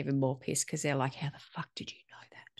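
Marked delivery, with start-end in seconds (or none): 1.2-2.5 whisper. Retold or imitated.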